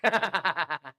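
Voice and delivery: a short helpless laugh; can't hold back a laugh; laughing at a student's reply; real laugh. Real, not acted. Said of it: "मैं हंस नहीं रहा हूं".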